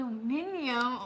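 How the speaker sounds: "Aluminium" tried the British way, a-lu-MIN-i-um; mock-British "Aluminium", five syllables, stress on MIN; imitating British pronunciation. Shown as a demonstration. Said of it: "did I say it right?".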